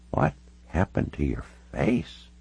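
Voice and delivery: put-on soft, slow elderly question; halting old lady asking softly; very old woman. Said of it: "She looked all of ninety-six years".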